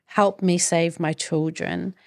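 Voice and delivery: ordinary delivery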